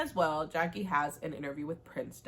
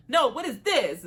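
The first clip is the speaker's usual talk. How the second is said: much higher and louder, accusing; loud confronting demand; 7 semitones higher, 10 dB louder than usual. Acted. Mother confronting her son in the garage, loud accusing demand. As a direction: demand an answer, voice way up. Mother rejects his excuse.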